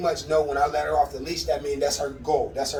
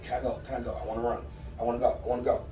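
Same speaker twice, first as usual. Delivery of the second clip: a hint of a dog, lower and softer; voicing the dog; 4 semitones lower, 5 dB quieter, much faster than usual. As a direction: murmur the dog's pleading in a low voice. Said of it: "and then she wait".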